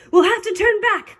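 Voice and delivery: high pirate call. A narrator's reading. Pirate tells shipmates to turn back, calling out. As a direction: call it over the storm. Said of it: "called another".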